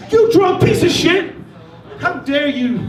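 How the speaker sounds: put-on yelling at a drunk bum in the park. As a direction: scream at him, outraged. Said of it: "I started to yell at him".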